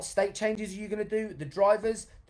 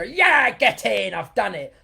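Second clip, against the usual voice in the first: loud, high, slow triumphant cheer; celebrating a win; 4 semitones higher, 10 dB louder, slower than usual. Pretend. Coach showing the class a big, slow celebration cheer. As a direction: cheer like you just scored. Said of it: "when you celebrate something and you go".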